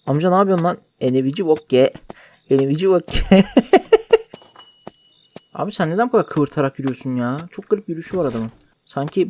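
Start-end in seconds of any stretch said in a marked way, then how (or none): 3.5-4.3 high, loud squealing laugh; high burst of laughter. Real, not acted. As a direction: let a shrill laugh burst out.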